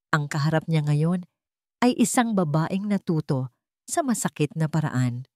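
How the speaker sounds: ordinary delivery, nothing marked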